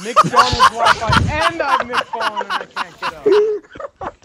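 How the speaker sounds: high, loud mock cry for help; drawn-out plea, hammed up for the joke; meme lady. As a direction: wail it slowly like the fallen lady in the meme. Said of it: "the lady in the meme".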